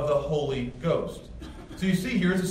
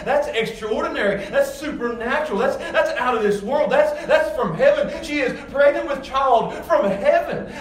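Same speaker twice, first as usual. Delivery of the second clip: rising, louder preaching with laughs of wonder; full-force preaching; 9 semitones higher, 5 dB louder than usual. Acted. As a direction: preach it out, marvelling and climbing. Preaching builds, pitch rising.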